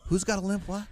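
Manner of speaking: high, befuddled politician's question; mock confusion, pitched well up; Joe Biden impression. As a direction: ask it as if totally lost. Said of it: "Biden said".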